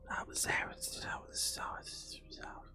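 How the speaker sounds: rapid, soft ghostly whisper; imitated whispering. Storyteller mimics whispering heard in the pillow, whispered. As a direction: whisper a stream of half-intelligible words. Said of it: "you legitimately hear".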